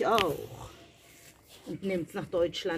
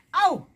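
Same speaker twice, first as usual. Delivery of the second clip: sudden high, loud "ow"; pained yelp; 10 semitones higher, 7 dB louder than usual. Real, not acted. Gardener pricked while planting, sharp cry of pain.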